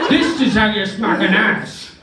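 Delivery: mock demo shout. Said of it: "he's like".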